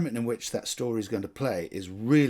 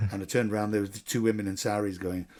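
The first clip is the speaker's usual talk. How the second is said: ordinary talk, nothing marked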